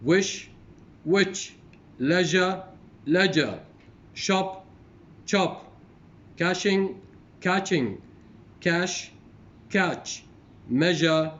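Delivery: loud, separated words, rising then falling in pairs; teacher's model pronunciation, each word on its own; word-list reading. Shown as a demonstration. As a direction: read out minimal pairs clearly for a class. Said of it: "going to read these, the words".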